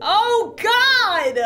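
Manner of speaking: high, loud startled cry; startled shriek. Real, not acted. Mom startled by the creepy baby, a loud high yelp. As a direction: yelp it out in shock.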